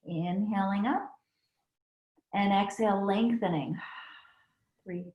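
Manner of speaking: soft counted yoga cues, easing down; calm instructor counting breaths; yoga breath cueing. Acted. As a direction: count them through the breath, gently.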